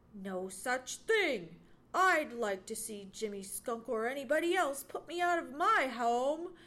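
loud, scornful denial. A narrator's reading. Johnny rejecting the squirrel's accusation, scornful. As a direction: throw the idea back with scorn. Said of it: "Johnny Chuck spoke scornfully".

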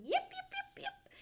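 high little yips, tailing off; high-pitched puppy bark; yipping dog. A narrator's reading. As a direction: yip like a tiring small dog. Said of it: "dancing around and barking".

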